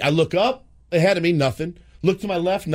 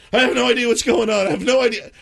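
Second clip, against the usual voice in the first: high-pitched flustered protest; panicked; 9 semitones higher, 3 dB louder, faster than usual. Retold or imitated. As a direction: act out a dad in a panic. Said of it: "I was panicked".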